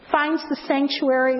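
ordinary talk

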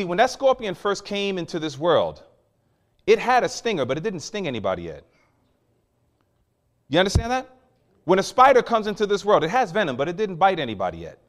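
ordinary talk

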